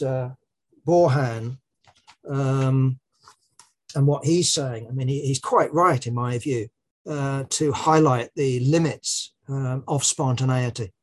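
ordinary talk, nothing marked

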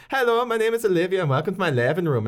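high, lilting Irish video-host greeting; imitated Irish accent. Video host introducing herself to viewers, imitated Irish accent. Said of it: "like Scottish or Irish".

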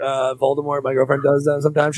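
ordinary talk, nothing marked

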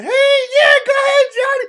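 high, full-volume cheering shout; loud imitation of cheering fans; yelling crowd. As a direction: yell it like a parent cheering from the stands. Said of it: "everybody yelling".

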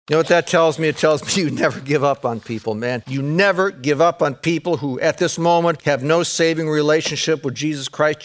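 usual speaking voice

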